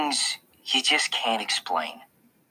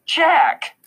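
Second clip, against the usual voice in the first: high, louder reprimand; scolding; 5 dB louder than usual. A narrator's reading. Mom to son who makes excuses, scolding. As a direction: call the name in shocked reproof.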